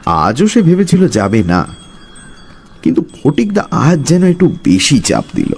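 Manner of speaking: ordinary delivery, nothing marked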